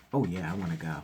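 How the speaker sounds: ordinary delivery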